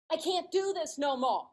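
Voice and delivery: high, loud wail of defeat; hammed-up cry of surrender; exasperated giving up. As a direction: throw in the towel dramatically. Retold outburst to her husband.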